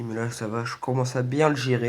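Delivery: ordinary delivery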